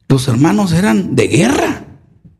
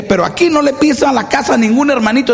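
higher, defiant son; defiant threat; 8 semitones higher than usual. Retold or imitated. Son defying his mother over church visitors, higher and defiant. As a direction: dare her, raised pitch.

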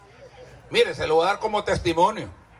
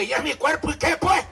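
mock defiant believer; 8 semitones higher than usual.

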